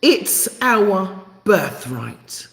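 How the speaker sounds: each word drawn out very slowly; slow, deliberate. Shown as a demonstration. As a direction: spell it out slowly for emphasis. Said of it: "say it one more time really slowly".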